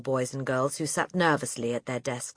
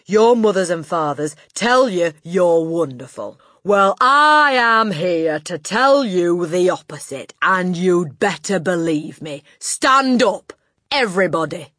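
loud, overbearing tirade; domineering headmistress, disgusted; 4 semitones higher, 12 dB louder than usual. A narrator's reading. Headmistress berating her new pupils, loud and disgusted. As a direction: rail at them at full volume.

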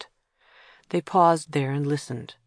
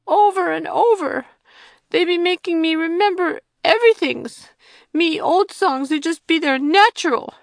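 put-on much higher, louder child's voice, complaining; a sulky little girl grumbling about songs; unhappy small child; 13 semitones higher, 8 dB louder than usual. Little Joe complaining to Kira about learning songs, sulky.

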